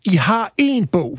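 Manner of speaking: provoked, hammer home "én"; indignant. Real, not acted. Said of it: "det provokerer mig lidt".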